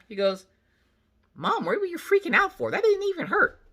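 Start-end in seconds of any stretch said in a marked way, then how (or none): 1.3-3.6 very high mock child voice; imitated kid voice. Son to mom after his shot, imitated high voice. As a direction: put on a high-pitched kid.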